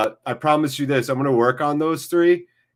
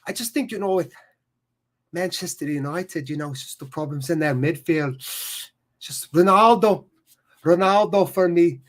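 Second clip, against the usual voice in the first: higher-pitched Scouse pundit impression; Jamie Carragher; 4 semitones higher than usual. Streamer mimics a pundit on Ronaldo, Scouse impression. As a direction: put on an excitable Liverpudlian pundit's voice. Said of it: "like, Jamie Carragher's accent".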